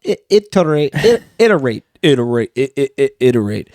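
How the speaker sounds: playful robot glitch on "iterate"; stuttering robot. Pretend. Host plays with a word he likes, mock robot stutter. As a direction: chop "iterate" into repeated stuttering starts like a robot. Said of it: "sounds like you're, like, a robot".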